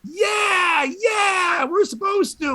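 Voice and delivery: high, drawn-out cheer; cheering crowd. Retold or imitated. Comic imitating an audience cheering a performer, high cheer. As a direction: whoop like a supportive audience. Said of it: "others were cheering".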